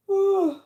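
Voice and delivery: loud open yawn, pitch sliding down; big yawn. Real, not acted. Streamer yawning loudly in the middle of chat, real yawn. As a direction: let out a huge real yawn. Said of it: "I just keep yawning".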